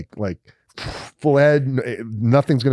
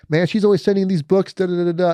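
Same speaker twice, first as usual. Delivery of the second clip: higher, playful friend voice, slowing at the end; teasing bunkmate; 9 semitones higher than usual. Retold or imitated. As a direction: tease him knowingly, voice pitched up. Josh voiced, tosses Bible.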